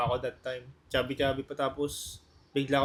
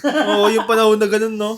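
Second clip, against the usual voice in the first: loud, higher, through laughter; laughing agreement; 5 semitones higher, 11 dB louder than usual. Real, not acted. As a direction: agree loudly while cracking up. Laughing agreement about old fad.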